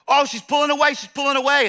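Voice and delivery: high, loud cheering at the screen; excited yelling. Retold or imitated. Pastor cheering a marathon runner on TV, high and loud. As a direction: yell at the TV as she pulls ahead.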